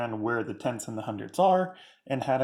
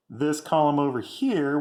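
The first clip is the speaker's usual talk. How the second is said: ordinary talk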